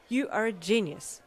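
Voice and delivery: happy exclamation. A narrator's reading. Ida praising his brother's letter idea, happy exclamation. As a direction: burst out with delighted praise. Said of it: "exclaimed happily".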